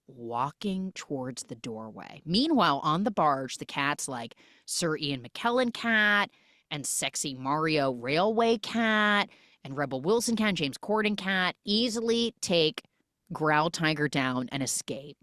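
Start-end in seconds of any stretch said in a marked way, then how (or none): none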